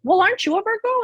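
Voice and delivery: high, bright-eyed friend asking knowingly; imitating her friend. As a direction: pitch it up like the eager friend. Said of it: "she's like".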